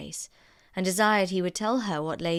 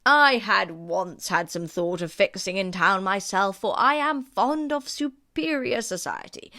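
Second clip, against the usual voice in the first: courtly Sir William; 3 semitones higher, 5 dB louder than usual. Acted. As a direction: savour each word like a proud host. Sir William's voiced dialogue.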